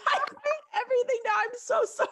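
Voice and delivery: high, tearful voice, laughing and crying at once; real tears breaking through the words; crying while laughing. Real, not acted. Host apologizing to her friend while crying and laughing. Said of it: "I'm, like, getting emotional".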